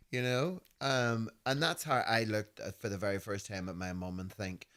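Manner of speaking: ordinary talk, nothing marked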